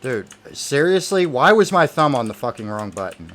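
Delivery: loud exasperated outburst, starting high; angry at himself. Real, not acted. Player berating himself for hitting the wrong button, exasperated. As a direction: burst out in frustrated disbelief. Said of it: "I'm pissing myself off right now".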